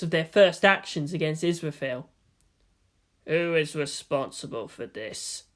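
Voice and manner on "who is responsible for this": low, slow, menacing quiet voice; dangerous. A narrator's reading. Gendo after the film, low and dangerous. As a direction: let the threat sit under the words. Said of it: "he asked, dangerously".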